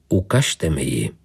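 low, firm order, falling at the end; uncompromising command. A narrator's reading. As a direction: order it in a low voice, no room for argument. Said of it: "zavelel nekompromisně". (nekompromisní rozkaz hlubším hlasem)

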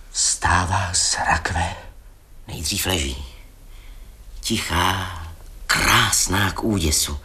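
slow hushed whisper of a ghost story; eerie whisper. Acted. Storyteller whispering to the frightened listeners, slow eerie whisper. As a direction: breathe it out slowly, barely voiced.

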